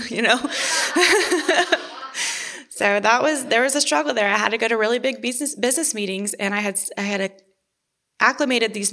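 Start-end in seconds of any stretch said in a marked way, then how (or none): 0.4-2.6 a high burst of real laughter; laughing out loud. Real, not acted. Laughing about her coworkers' reaction to no makeup.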